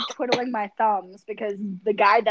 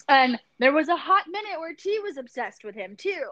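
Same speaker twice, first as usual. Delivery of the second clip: usual speaking voice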